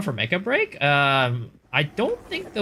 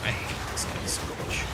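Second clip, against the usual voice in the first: frustrated whisper under breath; 10 dB quieter, faster than usual. Real, not acted. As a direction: mutter it to yourself, voiceless. Muttering in frustration mid-fight.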